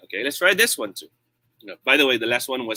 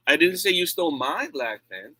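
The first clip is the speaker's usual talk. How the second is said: "my" pushed up and held; emphatic "my", the rest lighter; stress on MY; 3 semitones higher than usual. Shown as a demonstration. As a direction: lean on the word "my". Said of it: "I change the word stress".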